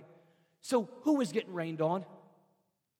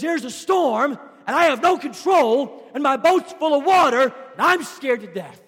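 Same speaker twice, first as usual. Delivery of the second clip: mock panicked disciples; 9 semitones higher, 15 dB louder than usual. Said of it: "All they knew is".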